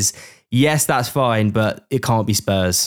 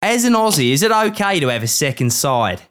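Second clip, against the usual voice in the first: put-on a shaky, half-hearted Australian accent with a high start; mock Australian accent; 5 semitones higher than usual. Host retrying the listener's question in mock Australian accent. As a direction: take a running jump at an Aussie voice. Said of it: "let me try again".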